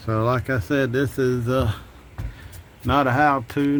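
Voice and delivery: normal talking voice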